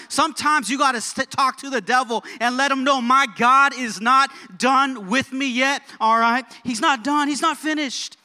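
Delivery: rally the room with full conviction; impassioned preaching. Real, not acted. Preacher urging church that God is not done, impassioned.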